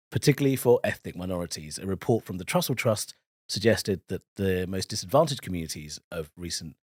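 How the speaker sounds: measured BBC news read; newsreader voice. Shown as a demonstration. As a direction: read it like a BBC anchor at ten. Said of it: "give us a newsread".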